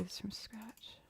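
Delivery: whispered to herself, almost secret; whispered aside. Real, not acted. Producer starting a fresh loop, murmured in a whisper. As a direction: breathe it out as a private whisper.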